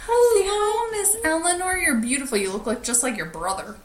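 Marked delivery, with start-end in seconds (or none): 0.1-2.4 high, sweet greeting. Real, not acted. Friend greets baby Eleanor in high baby talk.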